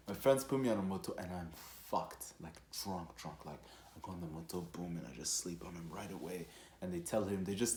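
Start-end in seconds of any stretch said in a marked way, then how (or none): none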